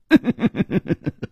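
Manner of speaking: short falling laugh; laugh, then stop dead; laugh cut short. Acted. Nan Gongling laughing before turning fierce. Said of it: "他的笑声忽然顿住了".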